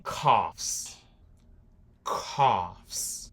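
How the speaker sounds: coughs said as kofs, the final s kept audible; English plural demo. Shown as a demonstration. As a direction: model coughs for learners. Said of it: "se tiver plural". (pronúncia de coughs com o s final)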